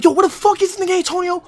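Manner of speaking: high loud frustrated shout; retold angry phone shout; mock yell. Bugging out on phone.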